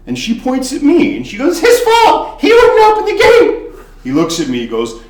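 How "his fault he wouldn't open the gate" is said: shrieking far higher and louder; girl screams at the bartender; accusing shriek. Retold or imitated. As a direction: point and scream the blame. Girl blames bartender, pointing.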